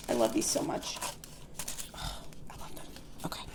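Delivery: breathy, hushed swoon over the paper; whisper. Real, not acted. Gushing to viewers over the planner stickers, whispered. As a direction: sigh it out, soft and unvoiced.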